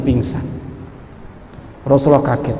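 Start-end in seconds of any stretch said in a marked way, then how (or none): none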